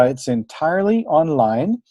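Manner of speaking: ordinary delivery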